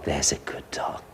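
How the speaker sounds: soft whispered praise. Shown as a demonstration. Trainer whispers praise to the calm puppy, soft. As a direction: breathe the praise to the dog.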